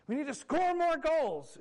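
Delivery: high, loud, slow mock yell; imitated mother's insistent yell; yelling sideline mom. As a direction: double down as a hollering soccer mom. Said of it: "she yells a second time".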